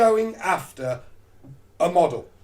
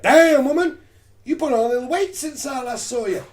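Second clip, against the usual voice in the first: higher, louder cocky taunt; cocky guy voice; 4 semitones higher, 3 dB louder than usual. Retold or imitated. Alpha male mocking his date's weight, cocky taunt. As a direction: swagger through the dig.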